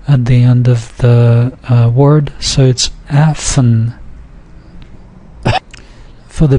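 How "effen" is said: Effen slow, with a syllabic n; two syllables. Shown as a demonstration. Teacher showing n being syllabic, two syllables. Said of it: "it's actually two syllables".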